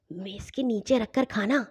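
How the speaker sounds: soft, secretive aside; hushed. A narrator's reading. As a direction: pass on a tip under your breath. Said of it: "ساتھ ہی آہستہ سے بولی". (آہستہ سے، دبی آواز میں)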